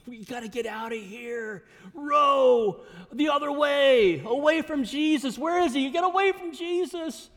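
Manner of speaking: loud, high mock-panicked cry to flee; panicking Peter. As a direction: call out frantically to the rowers, stretching the first words. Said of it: "if the old Peter would be like".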